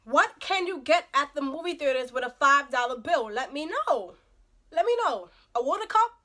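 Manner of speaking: loud, rising disbelief; incredulous outrage. Acted. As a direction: demand an answer, loudly scandalized. Outrage at five dollar bill.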